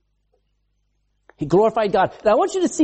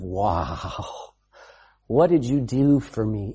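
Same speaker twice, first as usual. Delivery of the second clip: awed gratitude; 9 semitones lower, 4 dB quieter, slower than usual. Acted. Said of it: "constant attitude of".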